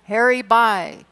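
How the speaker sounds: shouted call. A narrator's reading. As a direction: yell it across to him. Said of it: "we shouted".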